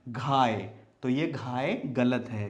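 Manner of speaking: normal talking voice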